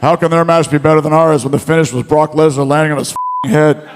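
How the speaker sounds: lightly voiced indignant wrestler; a hint of mock indignation; Shawn Michaels. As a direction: imitate a cocky wrestler arguing his case. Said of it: "He goes".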